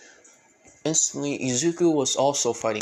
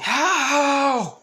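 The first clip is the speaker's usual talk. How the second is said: high loud cry; startled yelp; 14 semitones higher, 5 dB louder than usual. Acted. Naruto hit on the head, high yelping cry. Naruto yelps after being punched.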